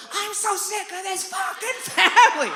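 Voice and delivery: high, drawn-out furious scream; hammed-up shrieking meltdown; losing it. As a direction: scream it slowly, at the end of your rope. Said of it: "I lose my shit".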